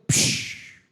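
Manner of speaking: sharp unvoiced burst like a gun; mock gunshot. Preacher imitating the husband's gunshot with a hiss. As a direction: spit out a short pistol shot noise.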